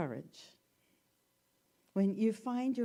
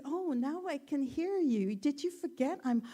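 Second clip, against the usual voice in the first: higher, brighter telling; cheerful frog; 7 semitones higher than usual. Retold or imitated. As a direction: explain it happily, a bit higher. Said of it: "And he said".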